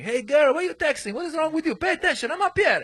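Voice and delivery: put-on very high-pitched goofy accented scolding; crazy character, silly accent. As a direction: nag a heckled audience in a silly high accent. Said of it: "some crazy character".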